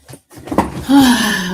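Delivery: loud, heavy exhale; sigh. Real, not acted. Streamer settles back in after taking the dog out, sighing. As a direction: let out a big sigh.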